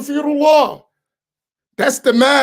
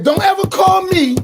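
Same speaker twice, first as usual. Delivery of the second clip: stern shout; 9 semitones higher, 4 dB louder than usual. Retold or imitated. Retold warning to daughters.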